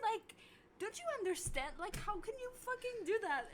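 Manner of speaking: high, loud, disbelieving rant at a friend; exasperated yelling. Retold or imitated. Scolding her roommate for running from the cops, exasperated yelling. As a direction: let it climb into an incredulous scolding.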